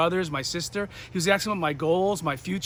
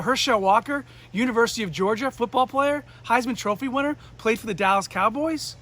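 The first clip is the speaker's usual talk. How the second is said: higher, rising questions; starstruck disbelief; 4 semitones higher than usual. Retold or imitated. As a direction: check each detail in excited amazement. Amazed reply to the name.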